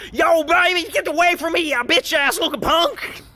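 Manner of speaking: high, loud mocking street voice. Commentator mocking Binks talking tough to the migrant, high loud. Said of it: "she's just like".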